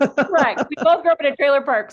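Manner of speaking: loud, fast, high burst of agreement; jumping in to echo her friend, excited and loud. Real, not acted. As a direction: blurt it back with delight. Laughing agreement about shared upbringing.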